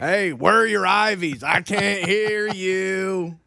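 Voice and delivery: drawn-out calling, last word stretched long; mock can't-hear heckle. Co-host heckling the mumbled impression, slow mock calling. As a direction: stretch it out slowly, as if calling to someone inaudible.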